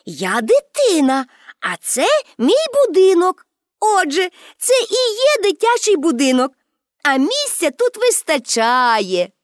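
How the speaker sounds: put-on high, triumphant little girl; proud. A narrator's reading. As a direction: declare it proudly, stretching the last words. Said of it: "гордо відповіла Пепі". (гордий дитячий голос)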